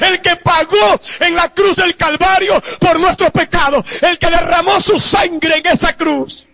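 high, loud, slow fiery sermon; preaching at full force. Real, not acted. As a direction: thunder it out from the pulpit. (predicación a todo pulmón)

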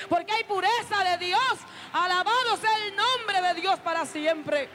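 building to high, fervent full-force preaching; prédica exaltada. Acted. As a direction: let it climb into a ringing sermon climax. (predicación ferviente que sube hasta el clímax)

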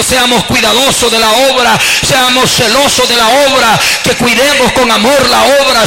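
oración fervorosa. Real, not acted. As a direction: pray aloud with rising fervor to God. (oración en voz alta y ferviente)

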